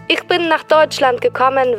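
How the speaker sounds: usual speaking voice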